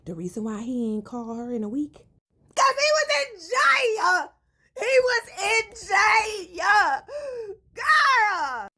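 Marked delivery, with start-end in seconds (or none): 2.6-7.0 high, loud, drawn-out cry of disbelief; dramatic shout. Real, not acted. Vlogger shouting the shocking reveal to viewers. 7.8-8.7 high, loud exclaim. Real, not acted. Vlogger exclaiming girl in shock.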